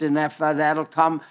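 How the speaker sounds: ordinary talk, nothing marked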